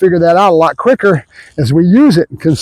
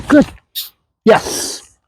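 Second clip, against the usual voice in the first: usual speaking voice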